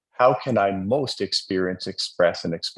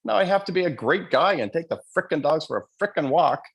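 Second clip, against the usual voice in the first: high, whiny grumble at the dogs; playful high-pitched griping; mock exasperation; 8 semitones higher than usual. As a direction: complain like a put-upon dog owner. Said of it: "I'm like".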